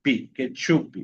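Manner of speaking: pi alone, then ketchup ending in "pi"; added final I. Shown as a demonstration. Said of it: "agregarle una I a la sílaba".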